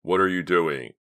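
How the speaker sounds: loud, angry demand. A narrator's reading. Leader confronting the driver who stopped, angry. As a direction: demand an answer forcefully. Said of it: "Clay cried angrily".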